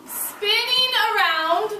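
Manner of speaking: slow, high, loud storybook reading; teacher reading the earth book to class; reading aloud to children. Acted. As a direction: savor each word for the class.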